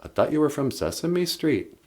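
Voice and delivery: usual speaking voice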